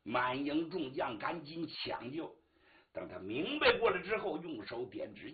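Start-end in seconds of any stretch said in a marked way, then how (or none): none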